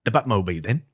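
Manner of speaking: put-on a clipped Michael Caine British accent; Michael Caine impression. Michael Caine accent done for a friend in a podcast chat. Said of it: "Michael Caine".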